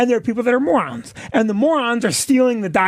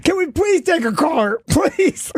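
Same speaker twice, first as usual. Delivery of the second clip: high, whiny mock-desperate plea; mock pleading; 6 semitones higher than usual. Cornered guest begs host to take a caller, mock pleading. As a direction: beg half-laughing, high-pitched.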